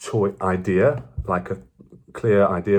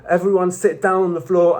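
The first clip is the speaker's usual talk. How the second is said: shouting; 11 semitones higher than usual. Retold or imitated. Students bursting into class to order everyone down, shouting. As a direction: imitate students yelling orders as they storm in. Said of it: "come in shouting".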